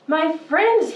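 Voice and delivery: clear keen announcement. Acted. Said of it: "he announced in a voice clear and keen".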